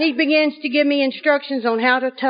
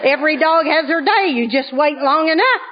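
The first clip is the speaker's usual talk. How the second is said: gleeful gloat; 3 semitones higher, slower than usual. Retold or imitated. Wife gloats after her husband asks for a tow. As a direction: crow over finally getting even.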